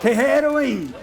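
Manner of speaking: pitched well above normal speech. Acted. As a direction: shout it out like an aggrieved ranter. Performs a catchphrase for the audience.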